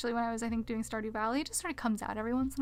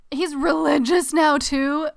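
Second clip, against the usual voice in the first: loud, higher, slowed-down disbelief; shocked disbelief; 4 semitones higher, 11 dB louder, slower than usual. Real, not acted. Streamer reacting to news of his conversion, loud disbelief. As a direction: blurt it out, really appalled by the news.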